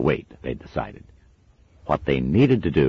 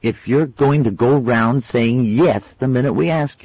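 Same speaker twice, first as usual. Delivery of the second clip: put-on severe girl; 11 semitones higher, 6 dB louder than usual. A narrator's reading. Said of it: "she added severely".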